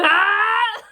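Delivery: loud crying yell of disgust. Retold or imitated. Throwing it in the trash, loud disgusted yell. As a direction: yell while throwing it away. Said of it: "I kept going".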